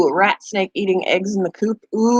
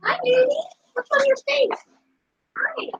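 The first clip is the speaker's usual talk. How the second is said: much higher cooing voice for the guinea pigs; 4 dB quieter than usual. Real, not acted. Owner cooing to her guinea pigs in baby talk. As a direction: coo up high at the little animals.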